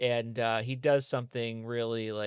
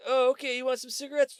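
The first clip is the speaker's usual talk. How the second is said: much higher, chirpy understanding voice; the cashier happily offering smokes; mock friendly clerk; 11 semitones higher, 3 dB louder than usual.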